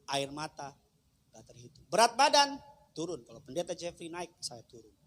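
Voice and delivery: ordinary talk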